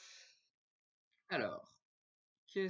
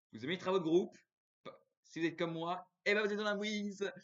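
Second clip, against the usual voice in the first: loud, raised complaining; emphatic rant; 7 semitones higher, 9 dB louder than usual. Real, not acted. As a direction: rant with exasperation. Ranting about group work.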